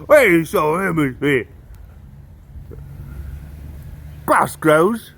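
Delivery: put-on Kiwi accent, sing-song and far from own voice; New Zealand accent. Comic showing how New Zealanders talk, mock Kiwi accent. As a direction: imitate a New Zealander talking. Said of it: "that's how New Zealanders talk".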